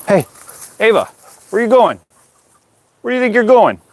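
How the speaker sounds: high, playful telling-off; playful scolding. Real, not acted. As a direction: tease the dog for running off.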